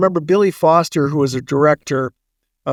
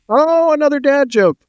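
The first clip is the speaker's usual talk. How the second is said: self-mocking groan; 10 semitones higher, 3 dB louder than usual. Real, not acted. Catches his own pun.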